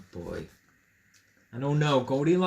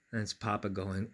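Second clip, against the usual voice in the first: usual speaking voice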